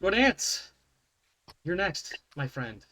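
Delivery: ordinary talk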